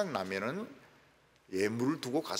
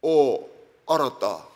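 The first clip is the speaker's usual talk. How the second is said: terse Gyeongsang dialect, loud first word; 퉁명스러운 경상도 사투리; curt Gyeongsang answer; 3 semitones lower, 7 dB louder, much slower than usual. Retold or imitated. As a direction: mimic a blunt Gyeongsang man on the phone. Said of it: "경상도 사람들은 이 스마트폰을 굉장히 간단하게".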